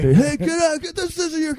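high strained movie-boss yell; mock yelling. Police boss to the cop who keeps investigating, mock yelling. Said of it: "he just keeps yelling at him".

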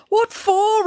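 very high, protesting outburst; indignant high-pitched challenge; indignant demand. A narrator's reading. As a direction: demand a reason, pitch leaping up. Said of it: "repeated Bernie".

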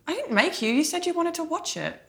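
high-pitched, defensive denial; indignant protest. Real, not acted. Mock quarrel over watching Barbie.

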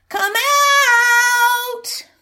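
high loud sung call; sing-song call. Acted. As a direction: chant it through the door. Boy calls back through door.

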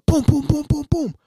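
loud, rapid vocal booms for banging fists; imitated door pounding. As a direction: punch out each boom like hammering a door. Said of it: "beat the door".

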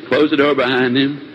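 normal talking voice

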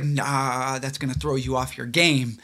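soft, low inner voice; trailing inner voice. Retold or imitated. Voicing his conscience to the cohost, soft and low. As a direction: murmur it like a conscience. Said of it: "trailing voice".

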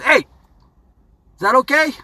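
high, slow, hesitant questioning voice; the little voice in your brain asking, slow and high; inner voice. Pretend. Brain's inner voice doubting, high and drawn out. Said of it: "this little thing that says".

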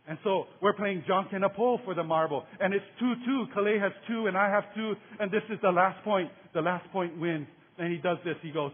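ordinary talk, nothing marked

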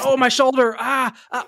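high fake pained groans; mock injured groan. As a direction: ham up a shoulder injury. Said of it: "He's like".

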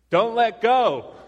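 slow, loud, high holler; shouted down. Retold or imitated. Guy above to dangling climber, shouting down. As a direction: call it down the cliff, stretching the words.

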